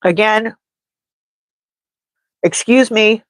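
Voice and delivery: just after blowing nose; nose blown, voice stuffy. Real, not acted. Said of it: "blowing my nose on camera".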